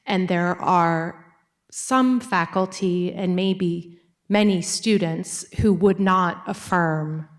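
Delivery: ordinary delivery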